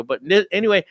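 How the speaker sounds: normal talking voice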